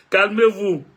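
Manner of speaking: chuckling "Calmez-vous", amused reassurance; laughing. Real, not acted. Vlogger laughing as he tells his followers to calm down.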